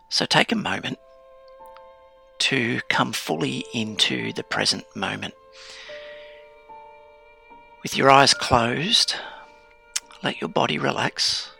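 calm grounding exercise. Acted. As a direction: settle the listener into stillness, unhurried. Guided grounding meditation, eyes closed.

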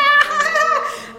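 high cackling giggle; mad child giggle. Retold or imitated. Daughter giggling with the gun, high mad giggle. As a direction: cackle with mischief. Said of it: "this mad laughter".

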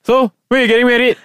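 high, fast blunt uncle question. Retold or imitated. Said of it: "the uncle will come and like".

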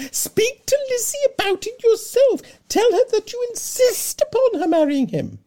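very high, loud, fluttering matron voice; fussy Mrs Bennet. Acted.

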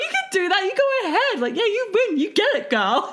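very high sing-song cheering; mock encouragement. Friend letting her competitive pal win, high mock encouragement. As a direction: humour her in a squeaky, indulgent voice.

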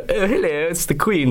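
high-pitched mock royal English accent; mock Queen's English. Actor mocking people's attempts at English, hammed-up Queen's accent. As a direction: put on a bad posh Queen's English voice. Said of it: "they do some sort of".

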